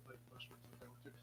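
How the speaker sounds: quick hushed whisper. Real, not acted. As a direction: whisper it fast. Hushed aside.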